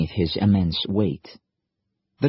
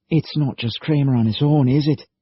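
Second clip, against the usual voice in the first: dawning dread; 7 semitones higher, 3 dB louder than usual. Acted. Liam's dawning realization.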